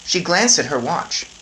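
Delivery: usual speaking voice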